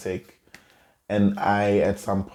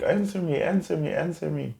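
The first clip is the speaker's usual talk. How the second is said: high, insistent repeated demand, fading; mother demanding; 8 semitones higher than usual. Retold or imitated. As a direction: imitate mum pushing for an answer. Mother pressing on the phone.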